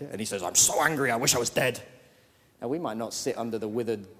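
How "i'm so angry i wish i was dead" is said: sulky anger. Retold or imitated. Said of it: "he's so angry".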